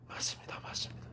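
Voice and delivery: whispered, submissive agreement; hushed admission. Acted. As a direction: give in meekly, almost without voice. Acted humble acceptance of rebuke. (숨죽여 속삭이듯 인정하는 말투)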